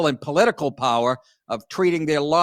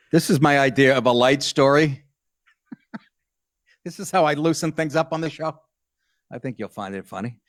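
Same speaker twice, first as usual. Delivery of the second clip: ordinary delivery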